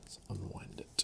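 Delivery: hushed whisper to self. Real, not acted. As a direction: breathe the words, barely there. Quiet aside near dog.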